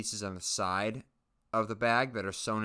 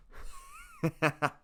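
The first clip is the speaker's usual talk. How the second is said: high squeaky laugh. Real, not acted. Laughing at own joke.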